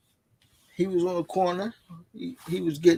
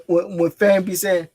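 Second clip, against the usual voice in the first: ordinary talk, nothing marked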